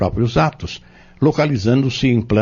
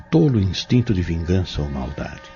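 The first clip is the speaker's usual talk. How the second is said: solemn prayer; 3 semitones lower, 3 dB quieter than usual. Acted. Fabrício prays for the tormented girl, solemn and pleading. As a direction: pray earnestly over the possessed girl.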